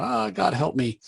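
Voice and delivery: half-hearted imitation of a victim's scream; mock scream for help. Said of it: "He's screaming out".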